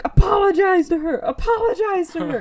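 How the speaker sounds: high, loud freakish demand; imitated unhinged shout; mock yelling. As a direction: screech it at them, hammed up. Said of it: "she yells at them in the third person".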